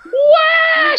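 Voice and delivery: very high, loud wordless wail; shrill mock scream. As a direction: let out a hammed-up shriek. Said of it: "She's screaming".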